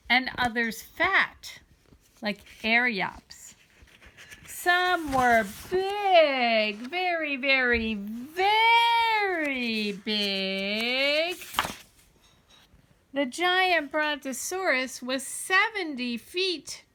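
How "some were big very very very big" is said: big and very stretched very slow; slow, swelling storyteller emphasis; drawn-out emphasis. A narrator's reading. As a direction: linger on every very to show hugeness. Emphasising enormous dinosaur size.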